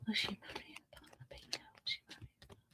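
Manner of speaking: very soft, private murmur; barely audible whisper to yourself; whispered question. Real, not acted. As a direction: whisper it under your breath.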